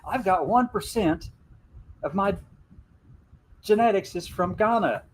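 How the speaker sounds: high, eager telling; lightly excited retold line; cheerful. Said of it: "I said".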